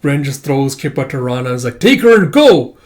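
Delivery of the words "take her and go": high, loud urgent order; shouting. Acted. Ren throws Kippa to Rana, shouting. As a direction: shout it to send them away. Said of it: "throat's getting dry from all the shouting".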